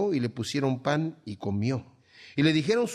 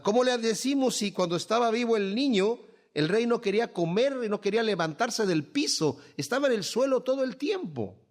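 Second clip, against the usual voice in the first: worried servants; 7 semitones higher than usual. Retold or imitated. Worried servants consult each other.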